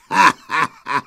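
loud mocking wolf laugh; wolves' laughter. A narrator's reading. Wolves laughing at the ewe they tricked, mocking laughter. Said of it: "Smáli se".

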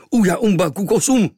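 high, loud wild yell; horrible shriek. A narrator's reading. Tattooed stranger waking the sleeping boy, shrieking. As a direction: shriek it like a war cry. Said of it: "příšerné zaječení".

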